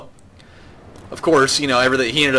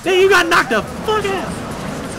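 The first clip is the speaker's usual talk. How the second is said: much higher, slow gloating sing-song; mocking taunt; slower than usual. Real, not acted. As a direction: gloat over the beaten boss. Taunting the knocked-out boss.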